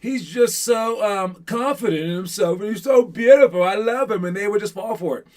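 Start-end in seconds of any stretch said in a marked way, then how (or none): 0.0-4.2 mock girl swooning over him. Coach imitating a girl gushing over a confident boy, high and swooning. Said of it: "the girls would be like".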